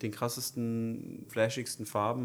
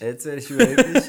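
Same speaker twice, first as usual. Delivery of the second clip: laughing; 5 semitones higher, 15 dB louder than usual. Real, not acted. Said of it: "Jetzt wird der überheblich".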